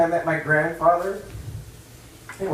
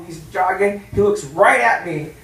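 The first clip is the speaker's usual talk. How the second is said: ordinary talk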